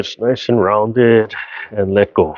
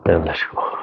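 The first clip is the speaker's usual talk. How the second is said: soft whisper; 6 semitones lower, 6 dB quieter, faster than usual. Real, not acted. Instructor whispering the release to the class. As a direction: breathe the words out unvoiced.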